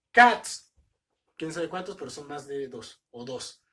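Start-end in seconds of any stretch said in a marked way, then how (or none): 0.1-0.7 Cats, shouted high and loud; loud emphasis. Shown as a demonstration. Teacher hammering the plural Cats for students. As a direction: punch the word Cats hard. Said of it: "teacher stresses plural form".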